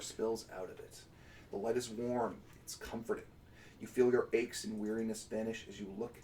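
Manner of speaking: soft, measured scene setting; story narration. Acted. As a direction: paint the vision quietly. GM narrates the vision.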